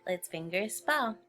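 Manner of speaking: ordinary talk, nothing marked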